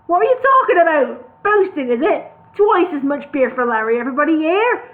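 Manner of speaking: bully them into drinking; boss rant. Acted. Brewery boss hectoring his sober workers, loud.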